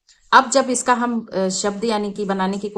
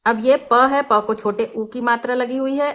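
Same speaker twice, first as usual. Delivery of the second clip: ordinary delivery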